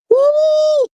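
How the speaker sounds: high piercing woo; triumphant whoop. Real, not acted. Celebrating vindication for viewers, high victory whoop.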